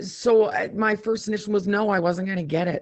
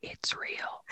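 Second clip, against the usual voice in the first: whispering; 16 dB quieter than usual. Real, not acted. Hushed reveal about signal.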